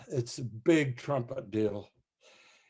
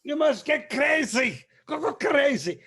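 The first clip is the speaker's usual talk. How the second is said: a much higher, louder mimic of the conductor; impersonating the conductor; 10 semitones higher, 4 dB louder than usual. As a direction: play the fiery maestro, urging madness. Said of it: "he says".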